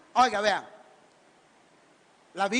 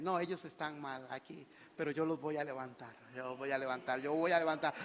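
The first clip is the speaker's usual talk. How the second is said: soft promising voice of God; 10 semitones lower, 11 dB quieter than usual. Acted. God speaks softly of raising.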